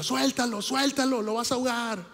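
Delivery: high mock shout of lifeguards. Lifeguards yelling at the wife to let go, high mock shout. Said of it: "le empiezan a gritar".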